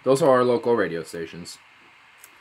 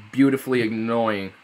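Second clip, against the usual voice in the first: ordinary talk, nothing marked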